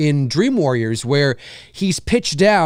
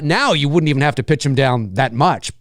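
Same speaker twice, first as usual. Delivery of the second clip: ordinary talk, nothing marked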